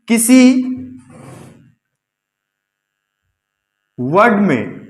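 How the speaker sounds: word by word, long pauses for students to write; slow dictation. Acted. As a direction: dictate the rule slowly so the class can copy it. Teacher dictates rule slowly. (धीरे-धीरे रुक-रुककर लिखवाना)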